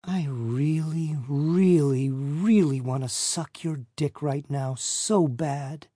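slow, sultry and hushed. A narrator's reading. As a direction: draw it out seductively. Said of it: "she says in a sultry voice".

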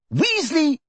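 high, excited call; delighted cry. A narrator's reading. Fudge calling Percy, crying out in delight. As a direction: cry out the name, quivering with delight. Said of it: "quivering with delight".

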